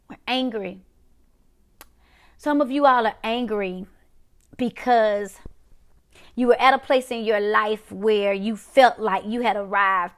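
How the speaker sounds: normal talking voice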